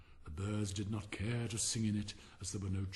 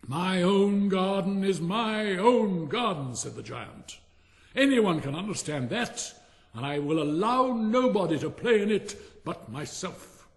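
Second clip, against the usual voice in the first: selfish giant; 7 semitones higher, 8 dB louder than usual. Acted. Giant declaring to all that his garden is his own, loud and stern. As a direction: boom out that the garden is his alone.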